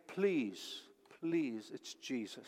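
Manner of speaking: soft, pleading hush; a mother cradling her child, softly pleading; tender plea. Acted. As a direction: beg gently like a mother over her child.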